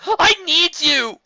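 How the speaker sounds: high, loud, slow desperate cry. A narrator's reading. Spike crying out to Twilight. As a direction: cry it out from the heart.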